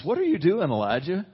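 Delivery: forceful retold divine question; emphatic God's question. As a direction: call it out like a searching demand.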